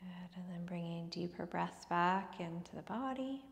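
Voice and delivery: whispered meditation cue; soft spa whisper. Acted. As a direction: breathe the words, no voice. Whispered Shavasana close.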